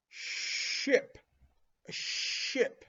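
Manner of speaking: ship with a long, hissed sh; sh stressed. Shown as a demonstration. Teacher stressing the sh in ship for children. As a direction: lean on the sh in ship. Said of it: "begins with the sh sound".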